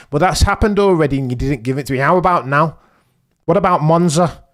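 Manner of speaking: ordinary talk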